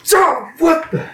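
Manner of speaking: high-pitched, stunned splutter; exasperated disbelief. Real, not acted. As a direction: splutter as if you can't believe it. Stunned friend never saw Tremors.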